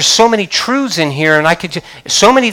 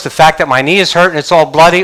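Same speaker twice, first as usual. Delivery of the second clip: ordinary delivery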